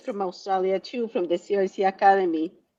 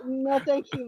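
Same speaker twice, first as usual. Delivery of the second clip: normal talking voice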